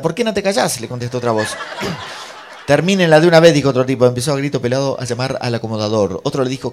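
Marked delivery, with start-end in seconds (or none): 0.0-0.8 high, loud mock challenge; exasperated retort. Otra voz replica en riña. 2.7-3.5 very loud mock yell; exasperated shout. Espectador corta la riña.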